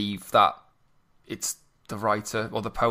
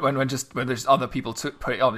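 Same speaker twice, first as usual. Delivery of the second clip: ordinary talk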